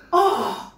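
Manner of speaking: storyteller's gasp and "oh"; big pretend gasp of dismay; shocked gasp. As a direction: gasp in mock shock for children. Tower has fallen in the story.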